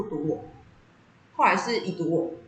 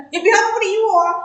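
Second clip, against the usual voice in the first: high, loud whining don't ignore me; whiny plea; 11 semitones higher, 8 dB louder than usual. Retold or imitated. Daughter to unresponsive mom, loud whiny plea. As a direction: beg loudly, half-joking.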